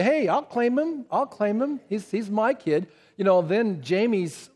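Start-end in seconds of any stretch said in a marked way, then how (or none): 0.0-1.1 smiling proud claim. Retold or imitated. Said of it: "I smile immediately and say". 1.1-2.9 smiling proud claim. Retold or imitated. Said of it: "I smile immediately and say".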